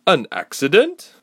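sharp, high disbelieving question; incredulous echo. Acted. Warrior woman doubting Ilse's story, incredulous. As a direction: throw the word back at her in disbelief.

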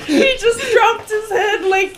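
loud, high, helpless laughter through the words; slow words breaking into loud laughter; laughing hard. Real, not acted. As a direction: crack up while trying to describe it. Cracking up over a death scene.

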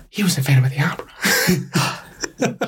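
breathless whispered gush, slow; imitating Mom. Son imitates Mom gushing over Patrick Wilson, whispered. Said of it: "like we always say".

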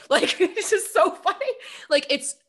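words through high laughter; laughing. Real, not acted. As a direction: laugh while talking. Laughing after the impression.

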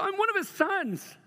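high, proud and bright; joyful declaration. Shown as a demonstration. Pastor showing men how to claim sonship, high and joyful. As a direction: announce it with delight. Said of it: "to walk out and go".

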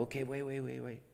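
backing off. Acted. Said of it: "sometimes back off and go".

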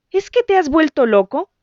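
high, loud disbelieving shout. A narrator's reading. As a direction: shout at him in alarm. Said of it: "gritó". (grito alto y alarmado)

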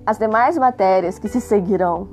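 ordinary talk

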